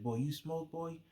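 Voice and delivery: lightly imitated teasing friend; teasing laugh. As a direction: needle him like you caught him out. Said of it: "he was laughing".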